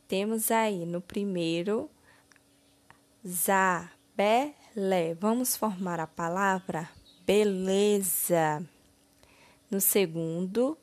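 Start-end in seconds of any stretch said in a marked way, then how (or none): 3.3-5.1 ZABELÉ read slowly as za, be, lé; three separate, drawn-out syllables in ZABELÉ. Shown as a demonstration. Teacher reading syllables to be ordered, slowly one by one. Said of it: "ordene as sílabas".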